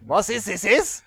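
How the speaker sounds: hammed-up French-accented question; mock French accent. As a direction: imitate a Frenchman asking a question. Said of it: "that's how the French speak".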